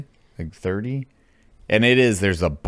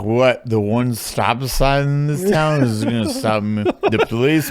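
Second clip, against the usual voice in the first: put-on raised, loud incredulous old-timer; defiant old local; 4 semitones higher, 5 dB louder than usual. Drunk old local dismissing the locals' warning, loud and incredulous. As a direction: scoff loudly, pitch up, as the old guy. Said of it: "He's like".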